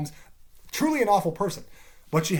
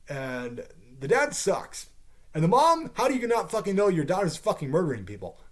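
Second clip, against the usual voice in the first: ordinary talk, nothing marked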